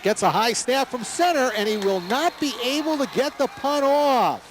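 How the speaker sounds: rising excited call of the play. Real, not acted. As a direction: call it high and fast as the play breaks down. Punt snap goes wrong, live call.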